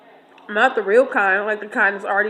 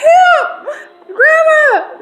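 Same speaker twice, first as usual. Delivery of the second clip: piercing high, loud retold cry; panicked scream; 8 dB louder, much slower than usual. Storyteller replays begging grandma to save her from goose, mock panicked scream. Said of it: "I was crying real tears".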